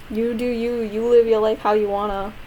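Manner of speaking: ordinary talk, nothing marked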